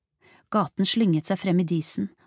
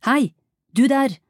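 loud, high call to halt someone; shouted call; 7 semitones higher, 8 dB louder than usual. A narrator's reading. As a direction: call out sharply to someone ahead. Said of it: "ropte Lucia".

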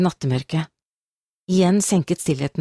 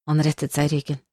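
ordinary delivery

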